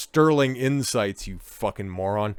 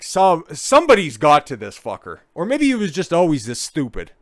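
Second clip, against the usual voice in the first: louder and higher, rising anger; getting mad; 5 semitones higher, 7 dB louder than usual. Real, not acted. Reacting to the interview, angry outburst. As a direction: let the frustration boil over. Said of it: "I'm getting mad now".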